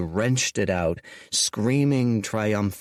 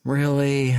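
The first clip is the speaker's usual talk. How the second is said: sighing; 5 semitones higher than usual. Acted. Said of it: "sighed Dorian".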